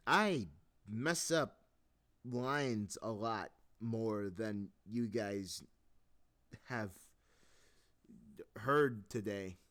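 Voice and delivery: slow, loud, exasperated venting; frustrated. Real, not acted. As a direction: drag the words out, fed up. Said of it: "I'm just so frustrated".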